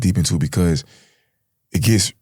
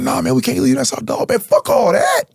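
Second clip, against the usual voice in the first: heated refusal; 13 semitones higher than usual. Retold or imitated. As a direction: refuse and shout over him. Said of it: "He's like".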